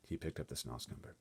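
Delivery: ordinary delivery, nothing marked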